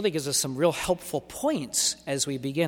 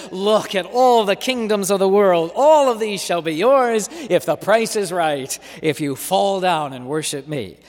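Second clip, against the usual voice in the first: louder, higher devil offering the world; tempting devil; 4 semitones higher, 8 dB louder than usual. Acted. Preacher voicing the devil bargaining with Jesus, loud and coaxing. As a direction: dangle the kingdoms like a bribe.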